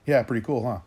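a hammed-up carefree reply to the question; mock teen shrugging it off; mocking her reply. Mimicking her breezy reply.

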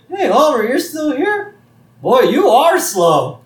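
high, loud cartoon ribbing; mocking surprise. Lenny taunts lingering Homer.

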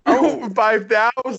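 high, slow, laughing correction. Real, not acted. Correcting a friend's lowball follower count, laughing. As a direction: laugh through the number.

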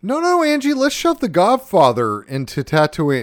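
high mock-cheerful sarcasm; sarcastic. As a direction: pretend to agree, dripping irony. Sarcastic fake support to cohost.

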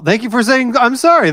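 sarcastic praise, rising high; mock cheer. Real, not acted. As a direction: overdo the thanks, mock-proud. Host mock-praises the apology.

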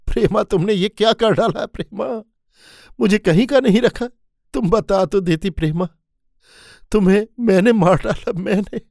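husband finding his wife burning, high anguished wail; anguished cry. Acted. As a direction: cry out in despair over her. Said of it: "वो चिल्ला पड़ा".

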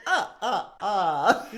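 loud, high cartoon vampire, laughing; mock vampire count. Mashing Gollum with a counting vampire, loud hammed-up accent. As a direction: boom it out with a vampire cackle.

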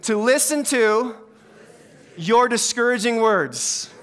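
slow, measured line for the congregation; feed it out slowly to be repeated; call-and-response prompt. Shown as a demonstration. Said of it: "ask you to repeat after me".

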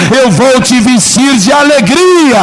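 high, slow shouted promise; climactic shout. Acted. Pastor crying out God's promise at the peak, slow high shout.

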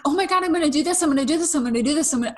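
high, eager mock excitement; excited rush. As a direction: gush like you just got a great idea. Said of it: "I'm like".

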